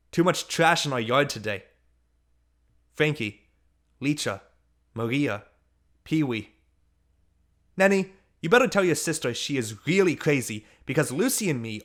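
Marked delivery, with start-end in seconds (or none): none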